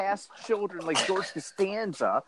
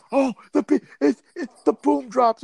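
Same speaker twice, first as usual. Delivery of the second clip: higher, dopey raver voice; ravers' voice; 8 semitones higher, 4 dB louder than usual. Retold or imitated. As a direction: play a clueless rave kid. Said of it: "They're like".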